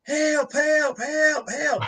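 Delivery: high distant cries for help; calling for help. Retold or imitated. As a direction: call out for help from far off. Retold call for help from cave.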